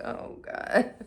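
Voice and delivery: breathy whispered oh God; whispered groan. Retold or imitated. Exasperated mom muttering, whispered. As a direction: mutter it under your breath. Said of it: "She was just like".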